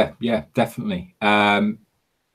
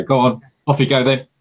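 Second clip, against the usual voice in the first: dismissive official; 6 semitones higher, 3 dB louder, faster than usual. Retold or imitated. Imitating officials dismissing defectors.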